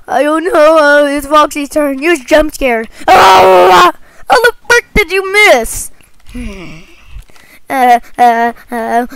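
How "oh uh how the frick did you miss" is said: loud high exasperated cry; frustrated shout. Real, not acted. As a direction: yell at the miss. Frustrated at missed attack.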